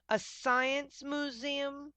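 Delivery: slow, high dismayed moan. A narrator's reading. Cow Martha dismayed at the museum, moaning. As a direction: drag it out in dismay. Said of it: "Martha moaned".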